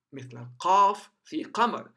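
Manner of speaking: ordinary delivery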